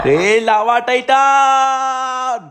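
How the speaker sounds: long calling shout. Acted. Trying to wake the sleeping titan, long loud shout. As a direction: bellow the name to wake him. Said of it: "అంత గట్టిగా అరిచినా కూడా".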